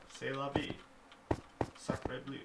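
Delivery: mock French accent. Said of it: "To Trump, any foreign language".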